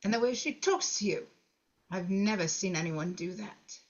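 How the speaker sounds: ordinary talk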